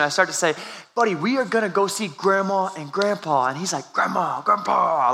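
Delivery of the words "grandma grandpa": slow, lightly voiced toddler echo; imitating toddler. Dad voicing his toddler, slow childlike echo. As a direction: coo the names like an excited little kid.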